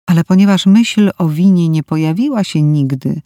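plain reading voice

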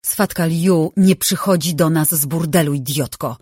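plain reading voice

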